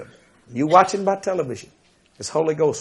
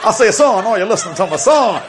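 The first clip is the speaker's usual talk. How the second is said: Foghorn Leghorn; 5 semitones higher, 6 dB louder than usual. Retold or imitated. Preacher mimicking the cartoon rooster for the crowd, loud and high. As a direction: boom it out like the big cartoon rooster. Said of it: "that big chicken on that cartoon".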